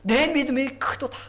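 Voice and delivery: loud, high-pitched, amazed proclamation; ringing cry of wonder; exclaiming in admiration. Shown as a demonstration. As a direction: marvel out loud at great faith. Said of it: "거기 감탄사가 있어".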